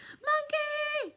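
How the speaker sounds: very high shriek of terror; high screaming cat. Acted. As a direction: shriek in panic at the monkey. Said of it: "screams the orange cat".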